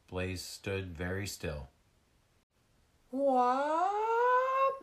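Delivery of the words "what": very high, loud, sustained wail; ringing wail. Acted. A lost seal calling for help, loud high wail. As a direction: let a piercingly high cry ring out. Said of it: "The sound rang out again".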